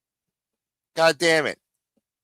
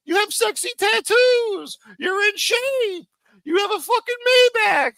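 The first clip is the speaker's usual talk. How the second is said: much higher, whiny sarcastic gushing rant; mock gushing fan. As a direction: parody a fan listing a boss's luxuries in a high voice. Mocking the guest's gushing praise.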